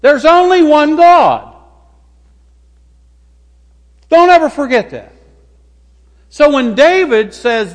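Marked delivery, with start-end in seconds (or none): 0.0-5.1 high, loud voice of God laying down the law; God commanding. Acted. God giving the first commandment to the people, commanding. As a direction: call the people to attention, emphatic and raised. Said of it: "he says".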